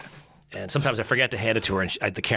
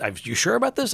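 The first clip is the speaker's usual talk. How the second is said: incredulous question; 11 semitones higher than usual. Retold or imitated. Asking doubtfully, retold.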